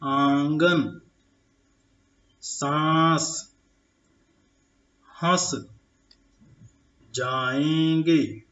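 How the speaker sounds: सांस and जाएंगे drawn out slowly; pronunciation demo words. Shown as a demonstration. Teacher to children, slow pronunciation demo. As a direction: let the nasal vowels ring. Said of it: "इसका भी उच्चारण आप करेंगे".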